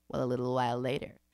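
ordinary delivery

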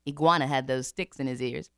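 plain reading voice

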